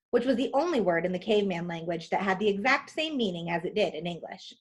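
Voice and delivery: ordinary delivery, nothing marked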